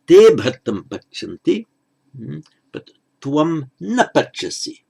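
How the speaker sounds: "Te" punched loud at the start; emphatic pronoun. Shown as a demonstration. Pali teacher showing the emphatic pronoun, loud. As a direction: hit "Te" hard, then contrast "tvam". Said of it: "You would say".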